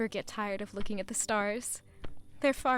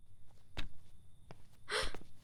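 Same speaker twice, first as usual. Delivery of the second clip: quick breathy gasp of delight; airy little gasp; soft surprised gasp; 10 dB quieter than usual. Acted. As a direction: gasp at something lovely. Sees frost on roses.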